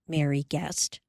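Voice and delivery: plain reading voice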